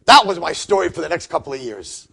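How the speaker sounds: ordinary delivery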